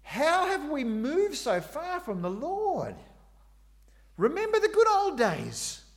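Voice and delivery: high, loud mock-wailing lament. Weeping people mourning the good old days, high wail. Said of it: "They cried and cried ... and howled".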